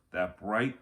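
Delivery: ordinary delivery, nothing marked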